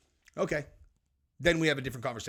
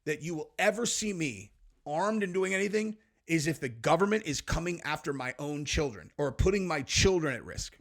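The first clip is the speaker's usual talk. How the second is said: normal talking voice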